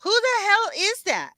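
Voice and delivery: high-pitched alarmed demand; freaking out. Real, not acted. Streamer reacting to an eerie clip, freaking out. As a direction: blurt it out, pitch jumping up. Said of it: "I'm freaking out".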